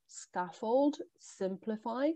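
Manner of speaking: usual speaking voice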